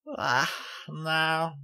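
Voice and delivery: high, sleepy filly voice; young filly yawning. A narrator's reading. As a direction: yawn through the words. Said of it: "she yawned".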